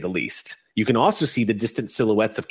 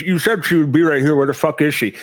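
words garbled round a lollipop; lollipop in mouth; 3 semitones higher, 4 dB louder than usual. Acted. Red cap griping to the goblin, mumbled round a lollipop. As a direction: talk higher with a sweet stuck in the mouth. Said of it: "talking this way 'cause he's got the lollipop in his mouth".

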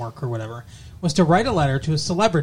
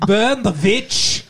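angry villager; 6 semitones higher, 3 dB louder, slower than usual. Pretend. Witch-hunt villager parody.

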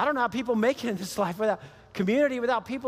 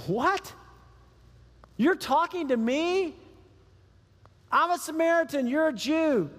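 higher, slow, disbelieving woman; incredulous Samaritan woman; 5 semitones higher than usual. Acted. Samaritan woman questions Jesus at the well, higher and unbelieving.

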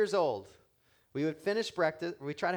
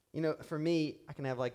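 usual speaking voice

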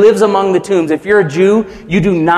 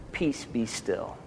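much softer and slow, gently stilling a storm; hushed calm command; 5 semitones lower, 17 dB quieter, slower than usual. Acted. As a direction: say it slow and soft, like calming a child. Jesus calms the storming man.

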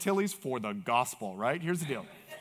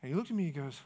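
ordinary talk

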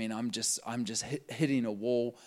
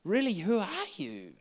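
amazed question; 9 semitones higher than usual. Retold or imitated. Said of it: "She said".

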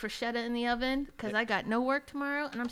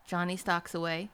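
ordinary talk, nothing marked

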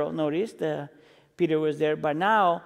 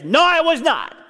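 higher, louder flat refusal; emphatic denial; 6 semitones higher, 4 dB louder than usual. Retold or imitated. Peter answering the girl with a higher, louder refusal. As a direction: shut it down hard. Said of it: "and Peter is emphatic and saying".

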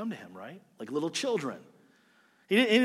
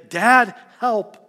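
louder, higher cry for help; urgent childlike call; child calling dad; 5 semitones higher, 9 dB louder than usual. Retold or imitated. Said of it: "and say".

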